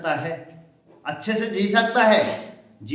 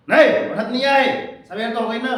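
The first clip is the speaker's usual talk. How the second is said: higher, louder scolding shout; harsh mother; 5 semitones higher, 5 dB louder than usual. Retold or imitated. Mother scolds her oversleeping son, loud and higher. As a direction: yell at the lazy kid to get up.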